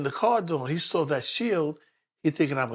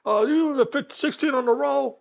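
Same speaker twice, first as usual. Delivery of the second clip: cop radio voice; 10 semitones higher, 6 dB louder than usual. Retold or imitated. Cop reading out radio check.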